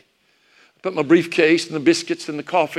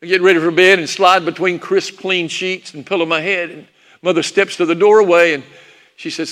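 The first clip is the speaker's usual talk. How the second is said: plain reading voice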